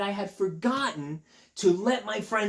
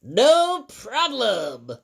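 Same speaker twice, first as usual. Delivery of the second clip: slow, louder little bird voice; 5 semitones higher, 7 dB louder, much slower than usual. Acted. Birds agreeing to leave Elephant's head. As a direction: answer as a cheerful bird.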